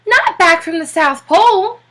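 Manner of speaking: can't believe he's back; astonished question. A narrator's reading. Popper, amazed, asks the Admiral about the South Pole. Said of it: "gasped Mister Popper".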